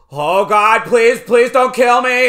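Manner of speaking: high, loud panicked American plea; Alan Rickman fake American. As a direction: shriek for mercy in an American accent. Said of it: "quote my favorite part now from Alan Rickman pretending to be an American".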